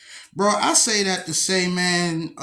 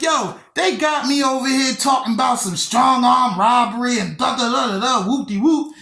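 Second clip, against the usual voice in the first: excited retelling; 5 semitones higher, 4 dB louder than usual. Retold or imitated. Excitedly telling sister his charges.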